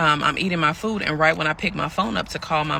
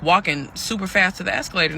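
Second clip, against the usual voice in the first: ordinary talk, nothing marked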